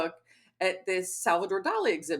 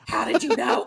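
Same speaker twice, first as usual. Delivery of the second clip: voiced, louder mock-horrified question; raised retold shock; aghast outcry; 5 dB louder than usual. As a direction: say it out loud as if found out. Friend's aghast reply, repeated louder.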